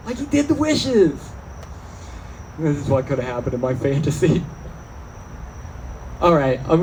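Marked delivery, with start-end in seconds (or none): 0.1-1.2 high, dopey stoner realization; stoned audience member. Retold or imitated. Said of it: "It's like".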